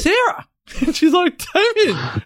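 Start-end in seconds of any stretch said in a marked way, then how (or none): 0.0-0.5 high surprised call of her name; surprised greeting. Retold or imitated. Neighbour surprised to find Sarah at Marcus's house, high and startled. 1.5-2.0 high startled name; surprised greeting. Retold or imitated. Sarah startled to see her chiropractor, high surprised call.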